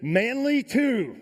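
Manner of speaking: high, slow, drawn-out command; commanding call. Retold or imitated. Doctor on speakerphone stopping the panicking climber, commanding. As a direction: stretch the name to cut through panic.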